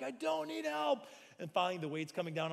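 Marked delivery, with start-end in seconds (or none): 0.0-1.0 high, slow, strained denial; stubborn strain. Retold or imitated. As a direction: insist through the effort. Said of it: "I'm like".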